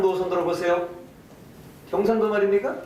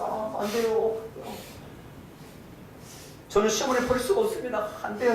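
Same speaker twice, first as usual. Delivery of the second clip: a touch of tearful pleading in a higher voice; crying refusal; 4 semitones higher, 4 dB quieter than usual. Acted. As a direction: plead through mild tears, like the weeping daughters-in-law. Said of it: "울면서 둘 다 거절을 했어요". (울먹이며 살짝 애원하듯)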